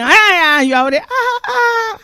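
high, laughing Ah; mock startled. Preacher mocking someone jumping at a greeting, high and laughing. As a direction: act startled, laughing through it.

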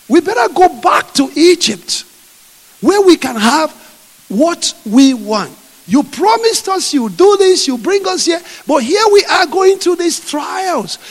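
high, loud accusing whine; grumbling Israelites. Acted. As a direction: throw the broken promise back at God.

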